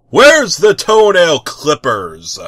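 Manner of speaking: loud, slow call across the flat. Acted. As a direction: shout the question from afar. Hero calling from bathroom.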